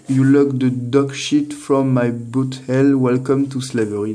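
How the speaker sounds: English read haltingly in a thick French accent; slow, drawn-out English with a French accent. Real, not acted. Vlogger reading a card's film quote to viewers, French-accented English. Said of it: "désolé pour l'accent, euh, anglais".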